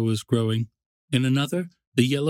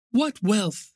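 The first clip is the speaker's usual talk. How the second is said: higher-pitched exclamation of wonder; exclaiming; 8 semitones higher than usual. A narrator's reading. Summer's wife admiring the harvest, exclaiming. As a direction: exclaim it in a high woman's voice. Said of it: "exclaimed the woman".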